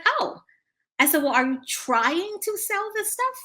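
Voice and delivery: very high little "oh"; high-pitched mock discovery; acted realisation. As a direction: react to a child, pitch leaping up. Acted surprise at boy's answer.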